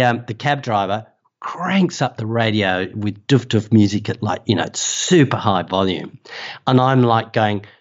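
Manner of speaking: ordinary talk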